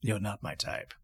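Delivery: low, soft villain retort; quiet, low put-down in character; disdainful villain. Acted. Villain answers player's insult in character.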